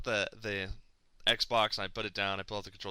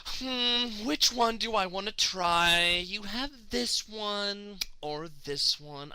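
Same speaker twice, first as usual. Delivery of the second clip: higher, slow, leisurely musing; mock dawdling clerk; 7 semitones higher, much slower than usual. Clerk dawdles over games, slow mocking musing. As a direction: drag it out like a careless clerk.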